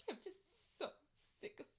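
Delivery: high, breaking sobby whine; mock crying. Q answering "are you good?", mock crying. Acts out Q crying about placing.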